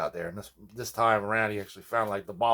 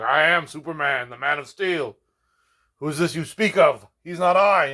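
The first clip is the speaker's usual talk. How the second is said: higher, louder, pompous mock hero boast; imitating kid Superman; 5 semitones higher, 5 dB louder than usual. A little cousin in costume tells his mother he is Superman, pompously. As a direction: puff up like a boy insisting he's the Man of Steel. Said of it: "he'd be like".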